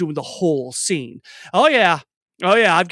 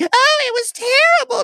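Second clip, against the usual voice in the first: very high, loud, wailing panic; hysterical waitress; 5 dB louder than usual. Acted. Waitress answering the sheriff, hysterical voice. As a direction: squeal it in an over-the-top annoying voice. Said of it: "that annoying, hysterical voice".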